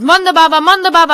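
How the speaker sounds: high, loud shouted name; yelling. A narrator's reading. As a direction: call out from the car window. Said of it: "they yelled".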